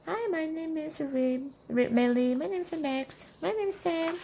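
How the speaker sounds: put-on small, high, soft kid voices; children introducing themselves. A narrator's reading. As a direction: give each name as a shy little child. Children say their names in turn.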